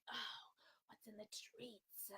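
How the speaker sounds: mock Gollum whisper, slow. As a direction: creep through it like Gollum. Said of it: "And it's like".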